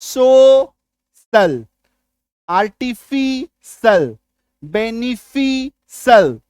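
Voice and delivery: सोशल, आर्टिफिशियल, बेनिफिशियल each drawn out slowly, ending in "sal"; slow, clear demo of each word's "sal" ending. Shown as a demonstration. Teacher drills students on the "sal" ending, slow and deliberate. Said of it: "सल sound सुनाई दिया तो सीआईएल लिखो".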